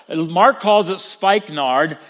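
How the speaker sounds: ordinary delivery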